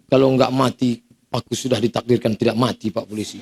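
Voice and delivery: low, soft comic reply; meek excuse. Acted. Driver answers the policeman about the red light, meek and quiet. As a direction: trail down softly, as if cowed by the officer.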